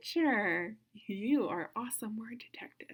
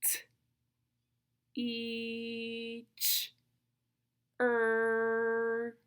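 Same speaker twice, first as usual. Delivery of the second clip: long held "E" and "er", pauses between; super slow sounds; much slower than usual. Shown as a demonstration. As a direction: sound out "T, E, ch, er" one at a time. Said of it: "Listen to me".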